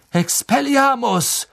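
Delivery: hoch und laut gerufen, langsam; gerufener Zauberspruch. A narrator's reading. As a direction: den Zauber laut hinausrufen. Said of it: "rief Lupin". (laut gerufener Zauberspruch)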